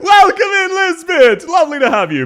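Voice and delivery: loud, high hammed-up posh Brit greeting; mock British accent. Streamer greeting Lisbeth in a hammed-up posh British voice. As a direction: call out a welcome like an over-the-top Englishwoman. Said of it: "This voice I'm about to do".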